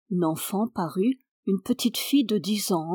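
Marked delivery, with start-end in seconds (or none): none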